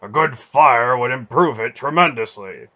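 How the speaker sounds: loud dry sarcastic grumble; complaining. A narrator's reading. Beric grumbling that the ship should burn, loud and dry. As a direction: mutter it like a threat. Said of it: "Beric complained".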